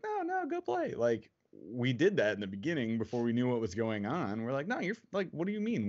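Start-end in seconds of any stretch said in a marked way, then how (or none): 0.0-0.9 jumps way up high, sing-song; squeaky, overly sweet parent imitation; mock high parent voice. Mock quote of parents to child. 4.7-5.9 raised high in mock disbelief. Retold quote of parents' disbelief.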